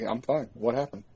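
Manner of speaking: soft, innocent reply after the chaos; mock calm innocence. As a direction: play dumb quietly, as if nothing happened. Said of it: "he's like".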